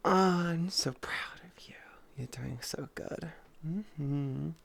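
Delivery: soft whispered praise; hushed doting whisper. Acted. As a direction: murmur it right into the dog's ear.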